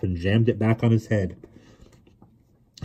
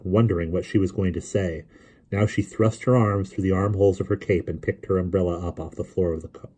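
ordinary delivery, nothing marked

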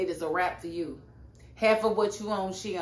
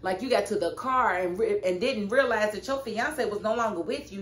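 ordinary talk

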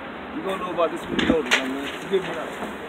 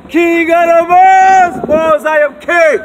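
louder, higher, slow proud shout; royal proclamation shout; 8 semitones higher, 10 dB louder, slower than usual. Real, not acted. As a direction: bellow it like a self-crowned king. Proclaiming himself king, shouting.

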